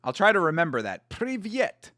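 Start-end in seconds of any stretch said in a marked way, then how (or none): 1.1-1.9 Privyet said higher with a questioning rise; practising Privyet. Shown as a demonstration. Host checks his Russian hello with guest, questioning. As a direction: ask if Privyet is right by lifting the end. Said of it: "did I say it okay?".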